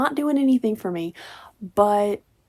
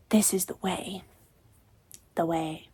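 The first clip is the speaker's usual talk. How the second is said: whisper; 2 semitones lower, 5 dB quieter than usual. Acted. Mock whisper quoting.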